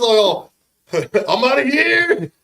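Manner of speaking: laughing, slow mock of a friend fleeing; laughing quote. Retelling how his best friend bolted, laughing. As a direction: laugh it out like he's bailing. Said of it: "He's just like".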